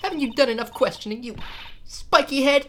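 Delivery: high, loud put-on indignation; irritated Miss May voice. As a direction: fling the insult out in a high theatrical voice. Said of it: "gotta get into this voice".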